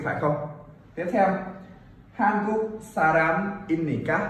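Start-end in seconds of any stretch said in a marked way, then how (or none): 2.1-4.2 한국 사람입니까 read slowly, with 한국 and 사람입니까 kept apart; slow, no linking. Shown as a demonstration. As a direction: read it slowly and carefully, word by word. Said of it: "Đọc chậm thì không nối âm nhé". (đọc chậm, không nối âm)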